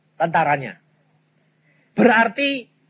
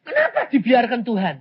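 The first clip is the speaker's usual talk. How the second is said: high-pitched, protesting questions to God; mock complaint; 9 semitones higher than usual. Preacher mimicking a believer blaming God, acted complaint. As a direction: cry out in acted reproach, questions piling up. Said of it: "Jangan lalu bilang gini".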